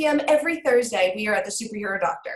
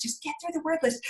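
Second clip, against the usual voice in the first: higher, softer retold plea; soft pleading; 5 semitones higher, 6 dB quieter than usual. Mother coaxing son through homework, soft pleading. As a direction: plead it softly, pitch raised. First take, softer aside.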